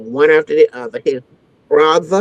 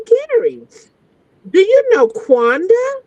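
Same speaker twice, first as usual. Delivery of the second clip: a much higher, sugary phone voice; put-on sweet voice; 12 semitones higher than usual. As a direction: play innocent and sweet on the phone. Retold sweet call to Gary.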